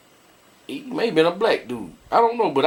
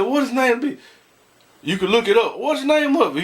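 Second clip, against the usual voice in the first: insistent; 8 semitones higher, faster than usual. Retold or imitated. Pressing the caller.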